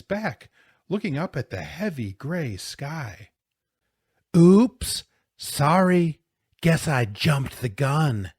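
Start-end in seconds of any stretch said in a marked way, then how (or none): 4.4-8.3 loud, high, gloating fake apology; mock apology. Bully after flattening a boy, falsely apologetic. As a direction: make the sorry big and insincere.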